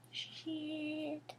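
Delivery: breathy unvoiced whisper; whispered curse. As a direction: let it slip under your breath. Fearful aside.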